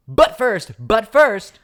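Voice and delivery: high, loud, punchy repeat; put-on excited interruption; shouted segment cue. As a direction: cry "but first" like a hype announcer.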